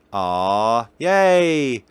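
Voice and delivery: normal talking voice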